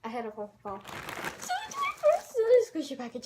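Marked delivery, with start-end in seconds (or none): 1.4-3.2 higher, fast and gushing; excited. Real, not acted. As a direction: rush it out in delight. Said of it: "I'm so excited".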